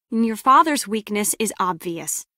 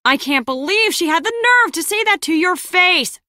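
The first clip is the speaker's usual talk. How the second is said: high-pitched indignant outburst; can't believe the nerve of her; outraged; 7 semitones higher, 4 dB louder than usual. Acted. Acted outrage on someone's behalf.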